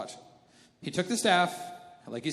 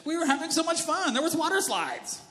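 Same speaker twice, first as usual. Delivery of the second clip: high, whining grumble; high-pitched moaning complainers; whiny complaining crowd; 8 semitones higher, 5 dB louder than usual. Pretend. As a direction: whine like spoiled travellers. Israelites complaining to Moses.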